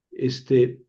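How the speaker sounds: normal talking voice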